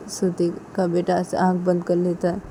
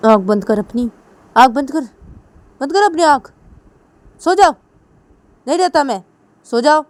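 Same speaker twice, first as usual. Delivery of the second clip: higher, much louder scolding, building; angry scolding; 7 semitones higher, 10 dB louder than usual. Acted. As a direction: snap at the child to shut his eyes. Parent scolds son to sleep.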